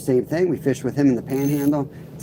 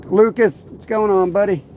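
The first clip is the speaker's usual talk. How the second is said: excited greeting; 8 semitones higher, 3 dB louder than usual. Real, not acted. Greeting viewer loudly.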